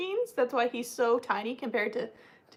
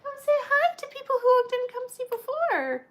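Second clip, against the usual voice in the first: high puppy talk; 12 semitones higher than usual. Real, not acted. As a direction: coo at the puppies like babies. Talking to puppies on camera.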